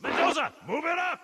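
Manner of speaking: calling out. Acted. Sergeant yelling to Mendoza to advance, loud call. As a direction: shout him forward.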